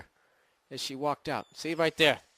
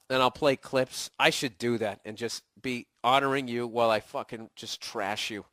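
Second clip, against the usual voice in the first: ordinary delivery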